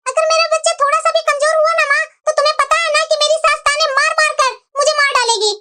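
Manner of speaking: ordinary delivery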